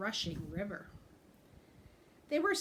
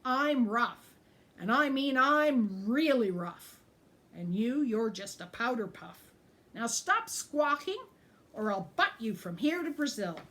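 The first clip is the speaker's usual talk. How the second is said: taunting bully; 4 semitones higher, 7 dB louder than usual. A narrator's reading. Goat taunting the ogre.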